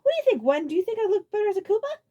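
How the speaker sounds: Roy voice. A narrator's reading.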